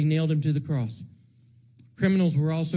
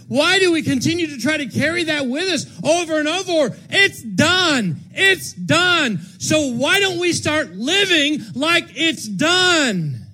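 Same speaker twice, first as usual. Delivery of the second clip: loud, high, slowing at the end; preaching shout; 8 semitones higher, 6 dB louder, slower than usual. Acted. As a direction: drive it home to the church.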